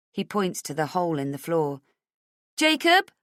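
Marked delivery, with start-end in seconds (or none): 2.6-3.1 high, loud call across the room. Acted. Mother calling for her son, loud and high. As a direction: shout his name to reach him. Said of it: "I call out".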